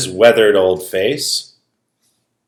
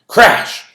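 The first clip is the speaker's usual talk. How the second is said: crash sound; 9 semitones higher, 5 dB louder than usual. Acted. Storm sound effect.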